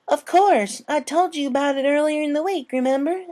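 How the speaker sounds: put-on a small girl asking her big sister; little filly voice. A narrator's reading. Apple Bloom reminds her sister.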